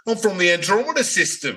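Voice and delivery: high, hammed-up alien voice; bad alien. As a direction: announce it like a cheesy space visitor. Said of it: "impressions. They're all bad".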